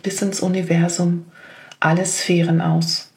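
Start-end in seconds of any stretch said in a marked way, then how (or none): none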